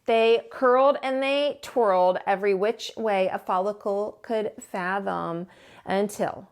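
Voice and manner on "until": ordinary delivery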